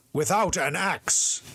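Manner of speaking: slow, sharp angry father. A narrator's reading. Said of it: "broke in the father angrily".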